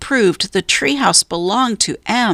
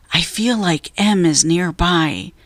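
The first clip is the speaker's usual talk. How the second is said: plain reading voice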